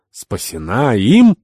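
drawn-out astonished question; exclaimed in disbelief. A narrator's reading. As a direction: cry out, incredulous. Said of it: "вскричал Скарпант".

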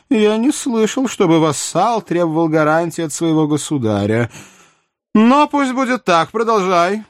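high-pitched, animated royal voice; theatrical high-voiced monarch; incredulous king. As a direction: scoff at a vassal's demand, then concede. Narrator voices King Louis.

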